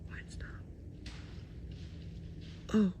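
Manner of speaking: faint, almost silent whisper; barely audible frightened whisper; terrified whisper. Real, not acted. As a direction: whisper frozen in place. Hiding from two creatures.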